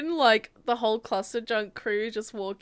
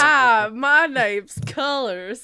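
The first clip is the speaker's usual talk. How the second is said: put-on disguise voice; 8 dB louder than usual. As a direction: introduce yourself in a bad loud disguise. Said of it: "talk in different voices".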